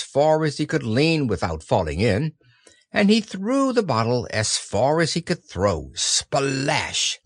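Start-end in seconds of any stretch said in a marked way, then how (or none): none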